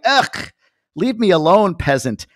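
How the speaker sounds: high, loud, haughty dismissal; a mock-snob waving off a fan; snooty brush-off. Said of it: "that's how I'm treating that".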